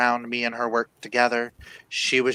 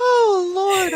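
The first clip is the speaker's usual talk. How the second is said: high laughing cry; 8 dB louder than usual. Real, not acted. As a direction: squeal it through laughter. Laughing reaction to joke.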